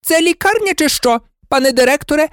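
very high, outraged nurse; shrieking indignation; hysterical. A narrator's reading. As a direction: scold in a frantic high voice. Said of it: "істерично вигукнула мадам Помфрі".

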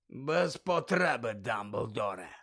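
slow hoarse growl; slow hoarse growl of an old auror. Acted. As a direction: growl it out gruffly, slowly. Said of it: "пролунало хрипке гарчання".

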